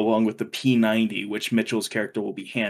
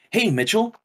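game character greeting; 10 semitones higher than usual. Retold or imitated. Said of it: "He's like".

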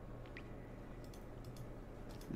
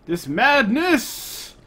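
dramatic; 12 semitones higher, 5 dB louder, much slower than usual. Pretend. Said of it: "repeats madness dramatically".

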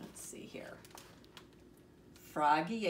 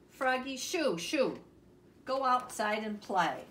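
loud, higher, brisk dismissal; shooing away; 5 semitones higher, 6 dB louder than usual. Acted. Mom sends Froggy out to play, loud shooing. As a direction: send the child out the door.

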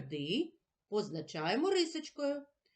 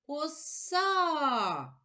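Оса called higher, stretching the last syllable; calling the word; 5 semitones higher than usual. Shown as a demonstration. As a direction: call Оса as if to someone far away, leaning on -са. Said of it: "Покличемо слово". (гукнути слово Оса з наголосом на -са)

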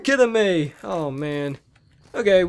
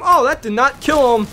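ordinary talk, nothing marked

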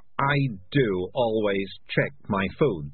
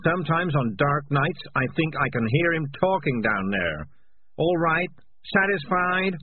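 agitated confession; 7 semitones higher than usual. Acted. Morris confesses to the rats he ate their friend, agitated and defensive. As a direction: blurt out the guilt, then push back.

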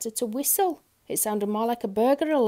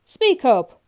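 loud, raised-pitch demand; impatient shopkeeper's shout; shouted order; 4 semitones higher, 12 dB louder than usual. A narrator's reading. Grocer cannot hear the whisper.

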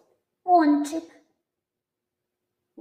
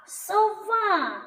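"Сова" called high with a long А; calling out; 7 semitones higher than usual. Shown as a demonstration. Teacher confirms the stressed А, high calling "соВАА". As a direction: call со-ВААА, falling at the end. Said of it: "Тут наголошений звук А".

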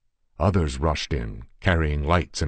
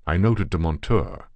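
plain reading voice

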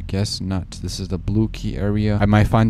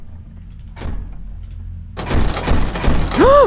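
sharp breathless fright gasp; startled gasp; 4 dB louder than usual. Real, not acted. Caught by the neighbor.

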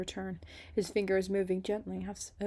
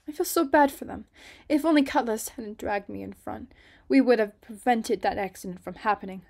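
distressed Ruby; 3 semitones higher, 7 dB louder than usual. Acted. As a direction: let the worry spill out loudly. Ruby's worried thought.

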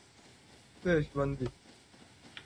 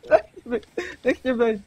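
high laughing whine; half-laughing high-pitched let me be; squealing plea; 7 semitones higher, 4 dB louder, slower than usual. Real, not acted. Laughing plea while attacked.

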